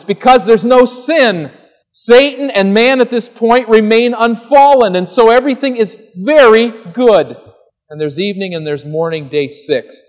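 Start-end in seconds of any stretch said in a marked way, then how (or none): 0.0-7.4 higher, louder preaching burst; full-force preaching. Acted. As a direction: preach it out with rising fire.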